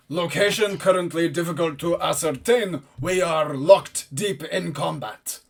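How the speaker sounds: powerful, formal commanding voice; Holthrein. Acted. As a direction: deliver it as a forceful combat report. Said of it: "a powerful voice".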